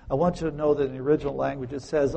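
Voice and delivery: usual speaking voice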